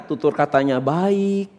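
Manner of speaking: slow, soft, lingering words; hushed and unhurried, mock-delicate; gentle. Shown as a demonstration. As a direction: demonstrate refined speech, stretching each phrase. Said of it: "Lemah lembut, betul?".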